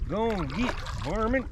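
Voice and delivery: high-pitched, hammed-up hillbilly chasing off intruders; mock backwoods landowner. Landowner shooing city folk off, mock backwoods holler.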